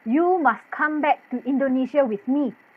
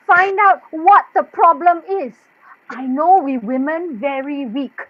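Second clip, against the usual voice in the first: usual speaking voice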